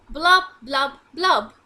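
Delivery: loud, bouncy blub blub; playful bubbling noise; bubble sound. Acted. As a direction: pop the bubbles out like a hippo. Bubbles blown in pond.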